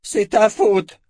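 loud, high-pitched accusation; accusing yell; angry shout. A narrator's reading. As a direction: cry out the blame with full force. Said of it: "cria le guerrier à la lance".

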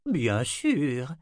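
plain reading voice

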